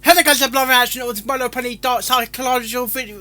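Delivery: high, loud and fast announcer intro; fast upbeat channel intro; hyped intro read. Real, not acted. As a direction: rattle it off like a stream host. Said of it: "Time for speech".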